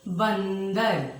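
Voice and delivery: बंदर said very slowly, nasal न drawn out; slow nasal demo. Shown as a demonstration. Teacher modelling the anusvara word for pupils. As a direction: hum बंदर through the nose. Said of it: "बोलते समय नाक से आवाज निकलेगी।".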